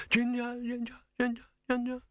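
high echo fading into the distance; echo bouncing away. A narrator's reading. Man's shout bouncing along caverns, fading echo. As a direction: repeat the name as a dwindling high echo. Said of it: "hear it bouncing away along caverns".

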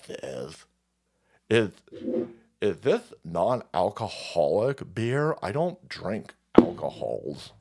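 lightly voiced snob; snooty vegan voice. Voicing a vegan partygoer.

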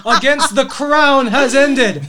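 high, loud, drawn-out proclamation; courtroom announcement. Acted. Door opens on the next courtroom's verdict, loud slow proclamation. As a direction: proclaim it slowly to a whole courtroom. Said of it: "the door opened ... and said".

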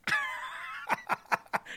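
high squealing laugh. Real, not acted. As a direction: burst of shrill laughter. Laughs at "artiste.

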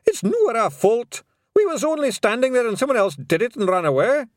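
high, loud Scots denial; loud Scots pixie protest; shouting Rob. Acted. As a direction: bluster your innocence. Rob Anybody.

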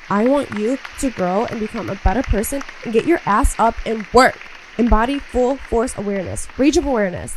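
rally them to get to work; fired up. Real, not acted. Fired-up motivational push.

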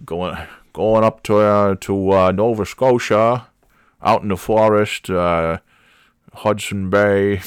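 mock Maine accent. Imitating an actor's attempt at it, mock Maine accent. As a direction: put on a muddled Maine accent. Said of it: "figured out how to do the Maine accent".

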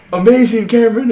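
high, loud, overdone delight; mock amazed praise. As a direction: gush like it's the best joke ever.

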